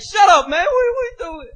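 high-pitched acted retort; irritated comeback. He snaps back at the stranger.

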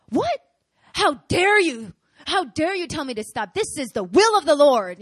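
high, loud, hammed-up indignation; scandalized shouting, key words stretched; acted outrage. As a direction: thunder back in mock righteous fury. Acting outraged reply to pastor.

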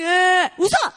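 loud mock whine snapped into an order; whimper, then burst out brightly; imitating fussy kids told to switch; whine into command. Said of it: "They're like".

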